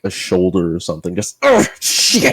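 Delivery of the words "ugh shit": high-pitched pained yelp and curse; acted yelp of pain. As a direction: yelp as if just shot. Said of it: "you just hear a yelp of pain".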